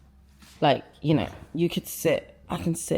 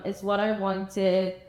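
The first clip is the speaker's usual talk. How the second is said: ordinary talk, nothing marked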